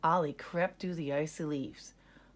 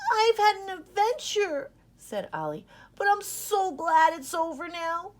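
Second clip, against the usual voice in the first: relieved puppy; 12 semitones higher, 5 dB louder than usual. Acted. Ollie reunited and relieved.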